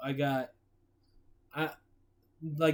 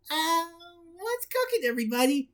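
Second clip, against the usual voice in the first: nervous; 8 semitones higher than usual. A narrator's reading. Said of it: "he asked nervously".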